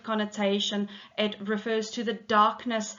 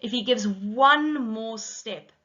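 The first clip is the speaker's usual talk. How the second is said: slow, louder "If he gives one more step"; emphasis on one more step; 4 dB louder than usual. Shown as a demonstration. Teacher showing how close death comes, slow stressed "one more step". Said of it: "emphasized again in line thirteen with that accent".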